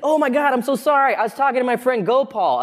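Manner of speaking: high, flustered apology; imitating an embarrassed man apologizing; apologetic stranger. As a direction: hurry to explain the mix-up. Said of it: "he's like".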